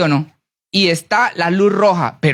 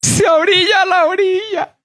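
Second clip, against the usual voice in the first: much higher, full shout; shouted answer; 11 semitones higher than usual. Real, not acted. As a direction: shout it out to the congregation.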